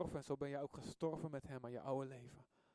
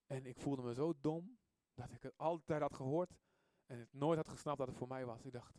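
ordinary delivery

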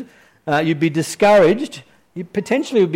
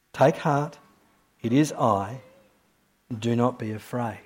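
tender repeat; 5 semitones lower, 7 dB quieter than usual. Shown as a demonstration. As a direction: let the words settle quietly. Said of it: "repeated for emphasis".

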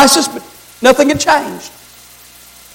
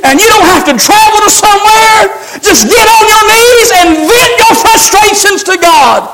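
rising preaching fervor; 6 semitones higher than usual. Acted. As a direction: push it up until you're almost shouting. Preacher building to a shout.

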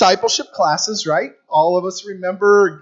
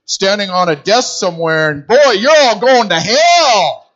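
mock hellfire preacher; 5 dB louder than usual. Mocking the old preaching style, hammed-up hellfire. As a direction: thunder it like a desk-top preacher, half joking. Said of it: "our box of thinking".

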